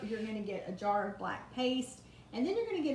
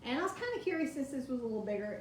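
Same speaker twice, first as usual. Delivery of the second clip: normal talking voice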